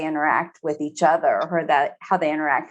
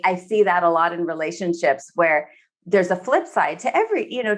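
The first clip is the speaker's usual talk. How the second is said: ordinary talk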